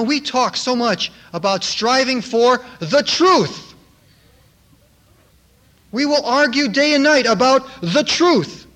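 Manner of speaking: fiery pulpit exhortation; preaching at full force. Acted. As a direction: preach it, building fervor.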